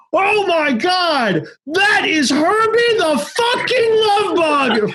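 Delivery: high, drawn-out scream of disbelief; screaming excitedly. Retold or imitated. Patient yelling from hospital window at famous car, screaming excitedly. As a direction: imitate an astonished high-pitched yell, words stretched out. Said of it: "you hear all these guys screaming".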